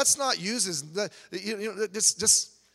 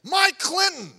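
mock curse; 10 semitones higher, 6 dB louder, slower than usual. Mock cursing with a name.

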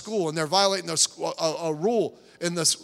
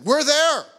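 much higher, louder We're there; preaching shout; 7 semitones higher, 6 dB louder than usual. Real, not acted. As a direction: hammer it home to the congregation. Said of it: "let me just tell you something".

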